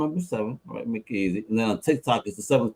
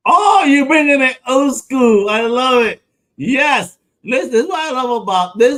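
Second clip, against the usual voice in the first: excited; 11 semitones higher, 8 dB louder than usual. Real, not acted. To the guest, excited about old-school anime seasons. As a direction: burst out with delight.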